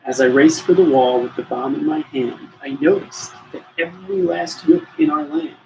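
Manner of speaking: ordinary delivery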